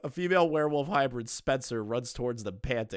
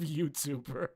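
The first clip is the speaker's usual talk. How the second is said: ordinary delivery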